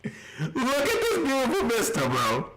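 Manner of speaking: mock praise breaking into laughter; laughing sarcasm. Real, not acted. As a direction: laugh through the fake compliment. Mocking the game's visuals, laughing.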